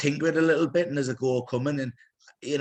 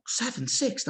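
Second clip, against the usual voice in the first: imitating excited son; 11 semitones higher, slower than usual. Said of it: "he's coming in now going".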